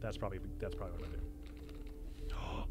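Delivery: soft whispered thought; whispered aside. Real, not acted. Streamer planning the turn, whispered thinking aloud. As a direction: whisper the plan to yourself.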